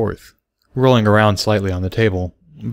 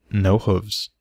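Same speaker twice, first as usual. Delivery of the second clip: commanding but gentle; 4 dB quieter than usual. A narrator's reading. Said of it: "in a commanding but gentle voice".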